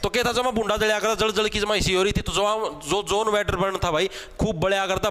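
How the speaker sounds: ordinary delivery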